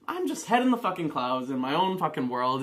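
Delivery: normal talking voice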